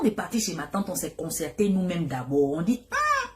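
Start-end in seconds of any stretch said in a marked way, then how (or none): 2.9-3.3 high mock sigh. Cousins deciding among themselves.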